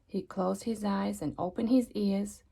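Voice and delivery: plain reading voice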